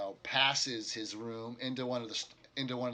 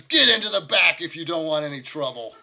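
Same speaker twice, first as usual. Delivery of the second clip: louder gruff warning; innkeeper warning; 5 semitones higher, 15 dB louder than usual. Acted. Innkeeper sending the party to the back, loud and stern. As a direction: push them into the back. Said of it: "he says".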